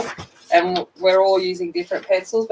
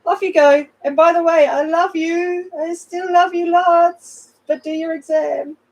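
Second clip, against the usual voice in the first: high, sing-song teasing teacher; playful teacher voice; 11 semitones higher than usual. Retold or imitated. Teacher to her students before the exam, high teasing sing-song. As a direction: lilt it up sweetly while insisting. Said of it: "I'm like".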